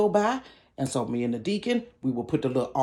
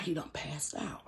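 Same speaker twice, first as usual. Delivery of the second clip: hushed, shocked whisper; 15 dB quieter than usual. Retold or imitated. Storyteller hushes as the boy collapses in church. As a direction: breathe it like a secret.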